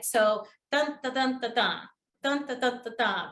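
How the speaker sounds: even spoken drum beats; steady rhythmic pulse, like tapping a drum; drumbeat imitation. Shown as a demonstration. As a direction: beat out the syllables in short groups with a pause. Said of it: "like it's like a drum".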